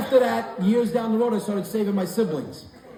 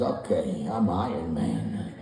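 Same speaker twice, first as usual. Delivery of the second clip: much lower, slow deadpan; flat grandpa; 11 semitones lower, 6 dB quieter, slower than usual. Retold or imitated. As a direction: say it like an old man who doesn't get the game.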